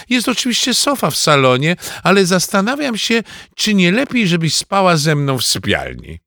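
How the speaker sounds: high, earnest mum voice; mother's voice. Acted. Narrator voices mother proposing.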